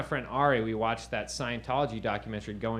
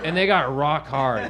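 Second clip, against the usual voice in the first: normal talking voice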